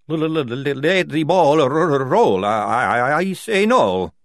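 loud, juddering stutter; severe stammer. A narrator's reading. Maledict answering Polly's call, stammering a refusal. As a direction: fight through repeated sounds to the word.

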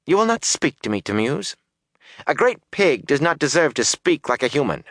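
high, haughty scolding woman. A narrator's reading. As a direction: cut him off with contempt. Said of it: "voices were raised in anger".

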